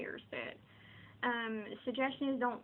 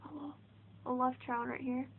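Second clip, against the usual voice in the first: ordinary delivery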